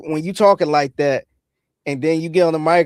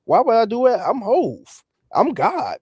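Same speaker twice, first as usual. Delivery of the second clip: imitating [name]; 7 semitones higher than usual. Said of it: "funny clip from [name] where he was saying".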